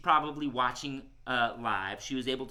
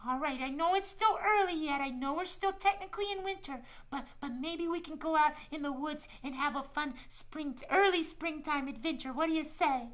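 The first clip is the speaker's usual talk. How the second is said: much higher, cheerful little squirrel; a friendly shy squirrel talking to kids; squirrel puppet voice; 11 semitones higher than usual. Acted. Squirrel puppet Shiloh tells the kids about winter, high cheerful voice.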